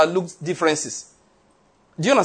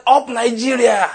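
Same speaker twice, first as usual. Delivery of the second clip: high, drawn-out cheer; rallying shout; 4 semitones higher, slower than usual. Retold or imitated. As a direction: stretch it out like a football chant. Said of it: "you should have shouted".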